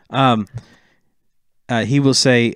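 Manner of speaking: normal talking voice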